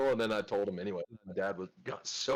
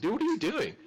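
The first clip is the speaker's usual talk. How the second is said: startled; 13 semitones higher, 5 dB louder than usual. Retold or imitated. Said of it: "I was like".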